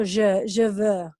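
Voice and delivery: "je veux" slowly, with rounded French vowels; slow French demo of "je veux". Shown as a demonstration. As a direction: stretch the vowels of "je veux" as zhuh vuh. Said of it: "si alguien habla francés".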